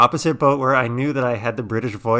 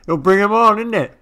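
mock British accent; 9 semitones higher, 6 dB louder than usual. Continues the British bit.

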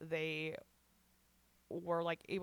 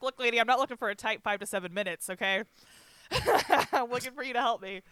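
higher and louder, sassy telling-off; mock exasperation; 5 semitones higher, 7 dB louder than usual. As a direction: put her in her place, half laughing. Retells confronting the psychiatrist.

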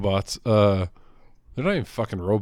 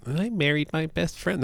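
high, mock-sappy voice; sing-song mockery, pitched up; mocking quote; 7 semitones higher, 3 dB quieter than usual. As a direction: parrot the cliché in a high, put-on voice. Mocking a saying he calls bullshit.

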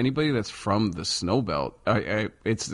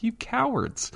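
high mocking cry, cowards pitched way up; mock yell; 14 semitones higher, slower than usual. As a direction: tease them with a sky-high squeal. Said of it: "I'm like".

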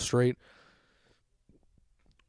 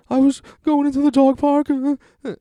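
imitating crying girlfriend; 8 dB louder than usual. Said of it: "She's, like, crying".

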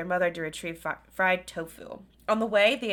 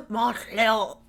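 Montreal said the French way, laughing, lightly put on; a playful hint of French on Montreal; mock French accent. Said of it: "Ooh, what was that?".

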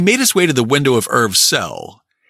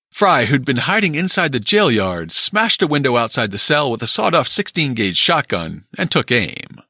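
ordinary delivery

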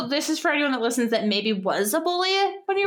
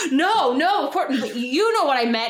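nervous laughing backpedal; 3 semitones higher, 3 dB louder than usual. Retold or imitated. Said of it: "And the guy's like".

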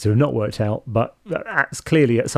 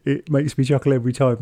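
normal talking voice

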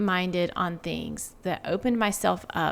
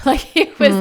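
laughing; 7 semitones higher, 9 dB louder than usual. Real, not acted. Laughing mid-sentence about failing parenting.

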